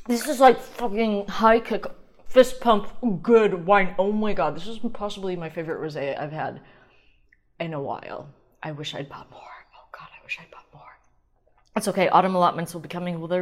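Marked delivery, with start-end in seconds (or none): 0.1-4.6 loud, higher, punchy outburst; excited raving. Real, not acted. Host raving to listeners about rosé, loud excitement. 8.6-11.0 very soft, unvoiced lament; regretful whisper. Real, not acted. Host regretting she bought too little, wistful whisper. Private regret over wine.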